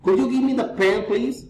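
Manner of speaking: polite model request, upturn on "please"; rising intonation. Shown as a demonstration. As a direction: raise "please" at the end of the request. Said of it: "reason, intonation".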